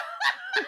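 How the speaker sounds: squeaky high-pitched giggle; weird high laugh. Real, not acted. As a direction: let out an odd shrill laugh. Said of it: "What the hell was that laugh?".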